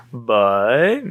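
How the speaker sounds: stretched word swooping up at the end; mock-baffled, slowly rising question; drawn-out disbelief. Repeats his stunned retold reaction.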